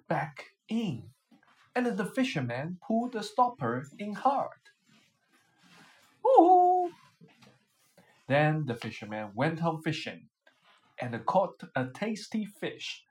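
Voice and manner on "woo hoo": high, loud victory whoop; triumphant woo-hoo; gleeful whoop. Acted. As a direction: cheer like you just won. Fisherman traps the genie.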